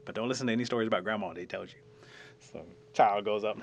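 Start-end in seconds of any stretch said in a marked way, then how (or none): none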